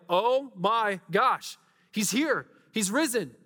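high, loud burst of awe; acted-out amazed disciples; amazed excitement. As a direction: draw out the first words in astonished joy. Disciples amazed as Jesus appears.

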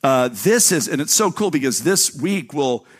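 ordinary delivery

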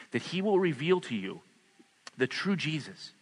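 hushed pastoral prayer. Acted. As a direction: pray gently over them, slowing down. Leads closing prayer.

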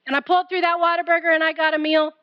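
usual speaking voice

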